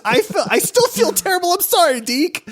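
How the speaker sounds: high, laughing mock-remorseful; a high guilty apology to the game elf; rueful apology. Real, not acted. Remorse over evil game choice.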